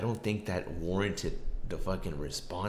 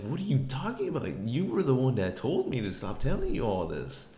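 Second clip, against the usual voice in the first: high, indignant protest; heated retelling of an exasperated comeback; incredulous retort; 7 semitones higher, 4 dB louder than usual. Retold or imitated. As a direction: argue back in disbelief, pitch jumping up. Retold quarrel, incredulous answer to accusation.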